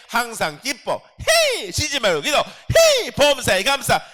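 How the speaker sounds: high, loud shouted declarations; shouted proclamation. Shown as a demonstration. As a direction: yell each command like a battle cry. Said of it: "그렇게 선포하는 거예요". (높고 크게 외치는 선포와 헤이)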